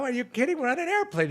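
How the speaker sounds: high-pitched mock protest; lightly hammed-up indignation; acted incredulous refusal. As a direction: object in a high, disbelieving joke voice. Joke figure refuses indignantly.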